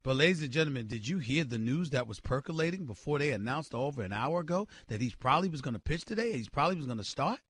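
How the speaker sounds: dropped to a low, soft, conspiratorial hush; hushed gossip. Acted. Host lets listeners in on hushed news, soft and secretive. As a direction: lean in and share the rumor quietly.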